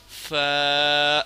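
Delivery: ordinary talk, nothing marked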